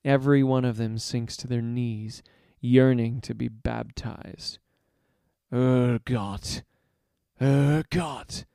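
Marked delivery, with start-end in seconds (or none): 5.5-8.4 half-roared chant; crowd roar. A narrator's reading. Followers acclaim their leader, roaring his name. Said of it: "they roar".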